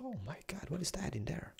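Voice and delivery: high, very soft near-whisper; whispered wonder at something hidden; hushed awe. Real, not acted. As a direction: breathe it in quiet amazement. Hushed at something unknown inside.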